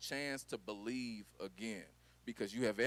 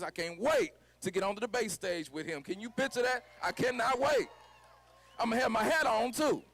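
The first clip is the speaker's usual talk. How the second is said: rally speech; 9 semitones higher, 6 dB louder than usual. Acted. Fires up the crowd.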